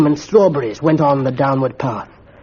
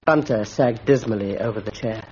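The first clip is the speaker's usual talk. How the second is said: ordinary delivery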